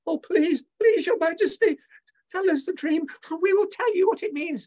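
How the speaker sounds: very high, pleading, cringing voices; begging astrologers. Acted. Astrologers begging the king for the dream, high whiny pleading. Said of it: "the astrologers begged".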